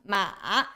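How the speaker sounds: MA dipping, then rising; low dipping tone on MA; third tone. Shown as a demonstration. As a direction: take "ma" down and back up. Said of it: "You go down and go up".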